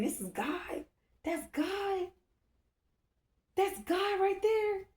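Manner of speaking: hushed high wonder, stretching the last words; retold amazement. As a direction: reenact being amazed, voice floating way up. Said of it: "I'm amazed".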